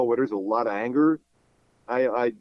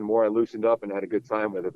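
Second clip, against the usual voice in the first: normal talking voice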